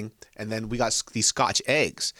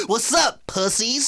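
taunting; 11 semitones higher, slower than usual. Retold or imitated. Fair people mocking them.

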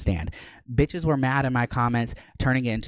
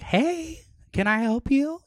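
high, sarcastic "can I help you"; mock polite challenge; slower than usual. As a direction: sweetly confront the nosy neighbor. Confronting neighbor filming her.

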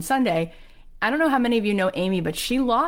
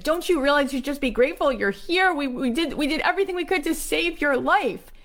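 high-pitched, exasperated retold outburst; acted indignant rant; angry doctor; 9 semitones higher than usual. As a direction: scold an ungrateful patient, pitched way up. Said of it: "He got so angry".

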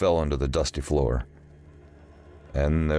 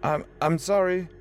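nervous stammer, much higher. Acted. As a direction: stumble over the words in fear. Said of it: "Joe stammered".

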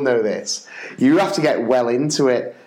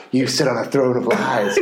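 Elf quote; 4 semitones lower than usual. Retold or imitated. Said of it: "a clip out of Elf".

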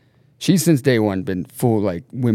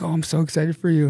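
excited sister; 7 semitones higher, faster than usual. Retold or imitated. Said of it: "She's like".

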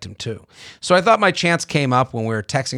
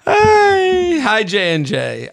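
drawn-out high-pitched hi; big stretched-out hello to open the letter; bright greeting; 6 semitones higher, 4 dB louder, much slower than usual. Acted. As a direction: sing out the greeting. Starts reading listener email brightly.

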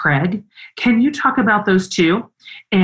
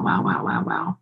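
whispered awe; 8 dB quieter than usual. Real, not acted. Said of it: "Oh, man".

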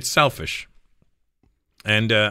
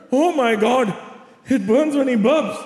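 put-on high mother voice parroting her child; mock the complaint as an exasperated mom; imitated mocking mom; 12 semitones higher, slower than usual. Mother mocks son's burning burp, high exasperated imitation. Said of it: "My mom goes".

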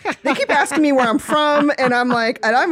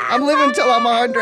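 laughing; 2 semitones higher than usual. Real, not acted. Joking about living long.

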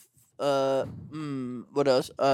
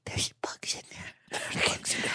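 put-on childhood whisper; 9 dB quieter than usual. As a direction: breathe it like a kid listening to the pillow. Kid hearing bugs in pillow.

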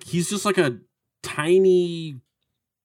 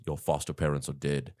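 lightly voiced Terminator, very low and soft; deadpan, low imitation of a machine; 10 semitones lower, 9 dB quieter than usual. Terminator breaking news to the boy, low deadpan imitation. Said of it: "he goes".

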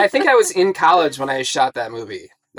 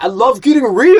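high, loud bro imitation of her line; mock frat boy, pumped and dumb; 10 semitones higher, 11 dB louder than usual. Friend teasing her line, in loud frat boy voice. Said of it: "you just frat boyed that".

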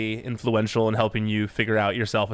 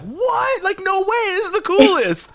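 much higher, thrilled fan squeal; excited; 5 dB louder, slower than usual. Retold or imitated. Front-row fans seeing her walk out, squealing with excitement.

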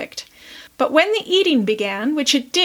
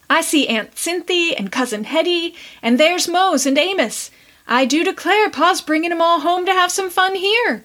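put-on high excited girl; excited cry; 6 semitones higher than usual. A narrator's reading. Prue crying out as she spots her relatives, high and excited. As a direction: cry out in delight at the visitors. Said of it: "cried Prue".

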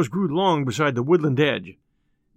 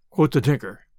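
ordinary delivery

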